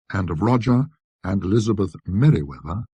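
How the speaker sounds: ordinary delivery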